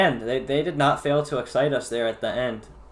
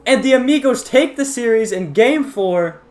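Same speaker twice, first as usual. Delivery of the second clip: high, loud winning goal call; excited shout; 12 semitones higher, 12 dB louder, slower than usual. Real, not acted. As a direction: scream the winner.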